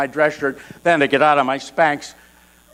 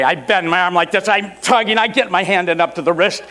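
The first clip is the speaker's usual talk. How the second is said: ordinary delivery, nothing marked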